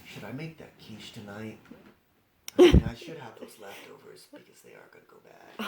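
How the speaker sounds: low, soft muttered musing; gorilla's inner voice. Acted.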